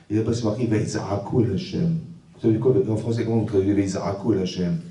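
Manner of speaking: normal talking voice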